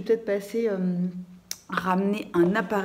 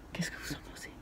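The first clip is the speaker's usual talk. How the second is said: whisper; 16 dB quieter, much faster than usual. Acted. Dramatic aside to viewers.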